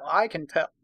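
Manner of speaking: usual speaking voice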